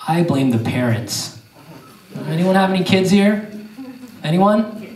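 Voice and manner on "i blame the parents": usual speaking voice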